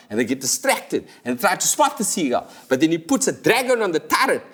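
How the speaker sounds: ordinary talk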